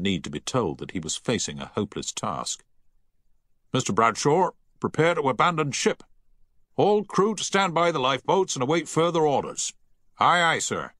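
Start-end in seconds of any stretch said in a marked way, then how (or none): 3.7-9.7 shouted orders. A narrator's reading. Captain ordering abandon ship. 10.2-10.9 shouted. A narrator's reading. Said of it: "shouted Bradshaw from the deck".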